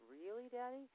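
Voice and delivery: put-on high, hopeful child's question; hopeful little girl. Daughter asking her father for reassurance, in a high hopeful child voice. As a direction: ask like a kid who wants to believe.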